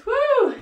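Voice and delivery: a very high, loud whoop; excited whoop. Real, not acted. Fitness coach pumping up her class, very high excited whoop. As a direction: squeal out a joyful whoop to pump everyone up. Coach cheering mid-workout.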